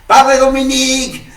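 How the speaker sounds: high, loud shout across the church; girl shouting for the priest from the church door, loud high call. Retold or imitated. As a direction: yell for the priest from the doorway. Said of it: "pegando voces desde la puerta".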